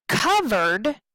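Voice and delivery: COVERED said clearly in two syllables; syllable demo. Shown as a demonstration. Teacher showing students the syllables, COVERED in two clear beats. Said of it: "has two".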